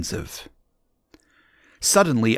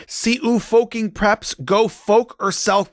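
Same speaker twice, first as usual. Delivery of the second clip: high-pitched hammed-up rant; mock angry rant; 9 semitones higher than usual. Author cursing the preps in a note, mock angry rant. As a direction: spit insults in a high, petulant holler.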